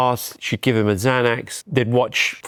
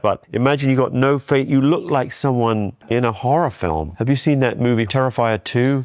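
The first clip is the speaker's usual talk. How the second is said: usual speaking voice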